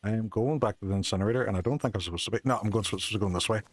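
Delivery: usual speaking voice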